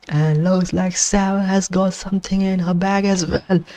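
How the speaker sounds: usual speaking voice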